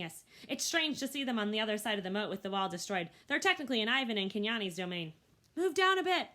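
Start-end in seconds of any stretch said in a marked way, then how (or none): 5.6-6.3 higher, louder clear instruction; instructing. A narrator's reading. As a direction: direct the herd firmly. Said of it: "Akello instructs".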